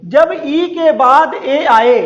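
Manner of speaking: slow, deliberate rule reading; dictating a rule to students, slowly; slow dictation. Shown as a demonstration. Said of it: "फिर से देखिए".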